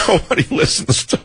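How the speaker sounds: wheezy laugh breaking through the words; real laughing. Real, not acted. Host jokes about his obscure show, laughing as he talks. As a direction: crack up mid-sentence. Cracks up at his own joke.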